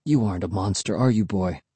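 soft, low talk to a dog; tender. A narrator's reading. Kid hugging the dog after the quarrel, soft tender question.

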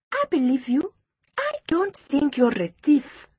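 put-on high-pitched reassuring child; high, sincere girl's voice; Precious, earnest schoolgirl. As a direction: reassure a friend in a high little-girl voice.

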